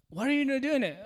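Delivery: high, loud exasperated question; exasperated dad. Retold or imitated. Dad scolding his son for not cleaning, exasperated. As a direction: demand it, fed up.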